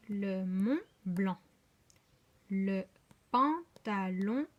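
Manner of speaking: each word of the list broken into spaced, held syllables; slow phonics read-back, syllable by syllable. Shown as a demonstration. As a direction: read out very deliberately, pausing after every article and syllable. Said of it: "Voilà pour la lecture phonologie".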